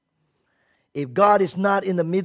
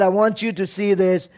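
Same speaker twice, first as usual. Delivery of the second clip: ordinary talk, nothing marked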